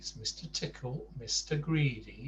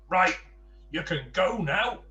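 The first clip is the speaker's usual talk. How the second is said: a touch louder, curt command; demanding; 6 semitones higher, 7 dB louder than usual. Acted. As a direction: order him out. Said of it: "demanded Mister Rude".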